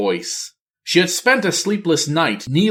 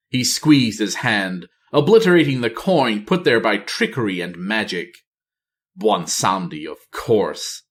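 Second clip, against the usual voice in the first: usual speaking voice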